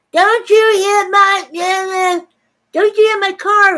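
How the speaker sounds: high, drawn-out mocking wail; imitating a woman's tearful screech; mock crying. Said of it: "she starts crying".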